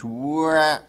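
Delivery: high distressed fawn wah. Shown as a demonstration. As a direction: raise the pitch on wah. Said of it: "increase the pitch to a higher pitch".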